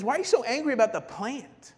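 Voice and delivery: high, loud, incredulous challenge; confronting God. Acted. God confronting Jonah about the plant, confronting. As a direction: confront him over something petty. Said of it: "the way God confronts him, he says".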